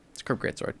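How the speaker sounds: whispered repeat of "It's a curved greatsword". Shown as a demonstration. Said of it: "unvoiced repeat".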